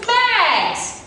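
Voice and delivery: high, sharp scolding call of a name; loud scolding. Acted. Mom scolding Mags at the dinner table, loudly. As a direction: mom snapping her daughter's name. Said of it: "my mom said loudly".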